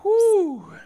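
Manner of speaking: long high-pitched whew; exaggerated high exhale of relief; relieved whew. Real, not acted. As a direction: let out a drawn-out, high "whew". Relief after telling the story.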